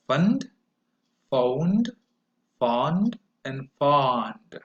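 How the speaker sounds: nut, note, not, not with the mouth opening wider; slow on the last not; vowel series demo. Shown as a demonstration. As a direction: model each vowel. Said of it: "my mouth opens wider".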